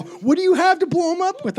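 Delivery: very high, loud disbelief; incredulous question. Retold or imitated. Brother questioning the bomb threat, incredulous. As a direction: squawk it like a baffled kid brother.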